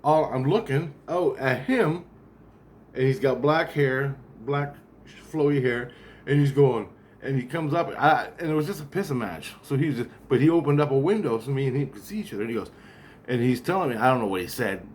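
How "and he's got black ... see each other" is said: usual speaking voice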